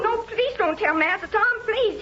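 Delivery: high, pleading begging not to be reported; frightened pleading. Acted. Servant terrified of the drunk master.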